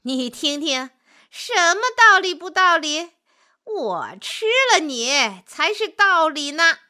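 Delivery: high, loud gloating. A narrator's reading. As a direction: crow loudly in triumph over your prey. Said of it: "心里更高兴了".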